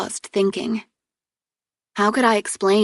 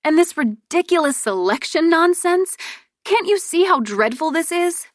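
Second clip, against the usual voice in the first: exasperated complaint; 10 semitones higher than usual. Acted. Sister complaining to brother.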